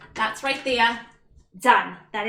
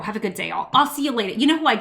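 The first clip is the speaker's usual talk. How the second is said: usual speaking voice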